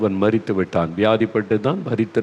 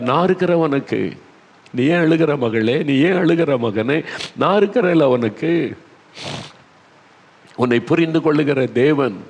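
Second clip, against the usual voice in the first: higher, impassioned altar-call preaching; fervent preaching; 4 semitones higher than usual. Acted. Preacher appealing to grieving listeners, impassioned and fervent. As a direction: plead with the hurting listeners from the pulpit.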